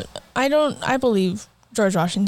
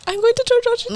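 high mock announcement; 14 semitones higher, faster than usual. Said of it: "I would be like".

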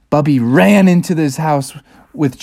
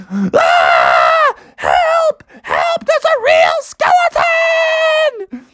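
high-pitched cries for help; scared screaming; much slower than usual. Acted. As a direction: shriek for help, stretching the last word. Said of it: "they were so scared".

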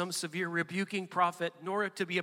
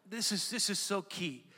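normal talking voice

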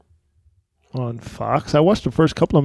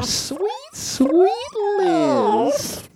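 very high slow squeaky voice; squeaky giggly alien; much slower than usual. Acted. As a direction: stretch it out high and creepy like an evil chipmunk. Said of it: "I came at her like this".

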